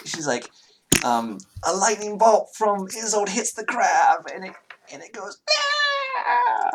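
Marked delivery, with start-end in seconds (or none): 1.7-6.7 put-on high child voice ending in a long dying wail; a child hamming up the crab's scream; kid playing monster death. Child narrates dying crab.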